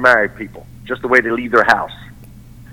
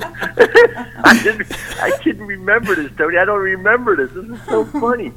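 high-pitched, laughing disbelief; cracking up, words pitched up by laughing; real laughter; 10 semitones higher than usual. Real, not acted. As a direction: talk through helpless laughter. Cracking up at forgotten story.

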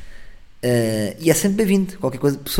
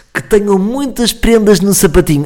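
higher, louder, slow and sugary; cheesy greeting; 8 semitones higher, 7 dB louder, slower than usual. Shown as a demonstration. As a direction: oversell the festive wish, slowly. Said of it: "Nunca digam isto a ninguém". (votos lamechas, mais alto e lento)

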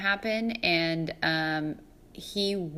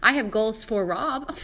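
ordinary delivery

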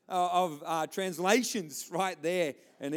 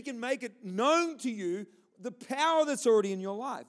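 higher-pitched, emphatic sermon delivery; fervent preaching; 5 semitones higher, 5 dB louder than usual. Real, not acted. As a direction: preach it with rising conviction. Preaches with raised force.

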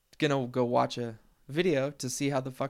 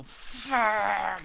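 sharp higher swear at the wheel; frustrated curse; 8 semitones higher than usual. Retold or imitated. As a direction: spit it out like slamming the wheel. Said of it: "you just go".

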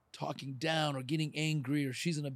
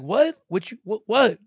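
higher and loud, spoiling for a fight; aggressive challenge; 6 semitones higher, 13 dB louder than usual. Retold or imitated. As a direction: bark it like a hothead. Old self picking a fight.

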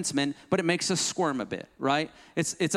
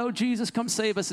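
mock damsel in distress; 6 semitones higher than usual. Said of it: "act more like the damsel in distress".

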